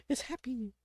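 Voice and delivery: high, soft delighted cooing. Real, not acted. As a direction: coo happily at the kitty. Cooing at the happy cat.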